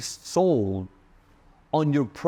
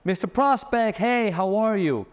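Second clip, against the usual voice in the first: bright high sales hello, slowed; pitched-up greeting; 9 semitones higher than usual. Shown as a demonstration. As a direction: lift your voice high to greet the client. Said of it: "when to pitch your voice".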